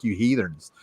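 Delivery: a quiet, half-joking scolding; mock scolding. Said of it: "And I'm like".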